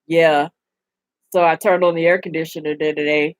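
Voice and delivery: flat deadpan joke delivery, mocked; imitating bland comedian. Mocking a comedian to her viewers, lightly deadpan. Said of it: "They can just be like".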